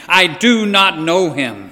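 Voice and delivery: furious slow shout; shouted denial. Acted. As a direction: shout every word of the denial. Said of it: "Peter shouted again".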